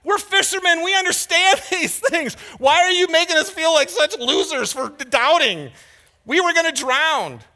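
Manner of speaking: high, loud, indignant disciple; exasperated protest. Retold or imitated. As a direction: complain at the top of your voice like a scared fisherman. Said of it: "I always wanted to go".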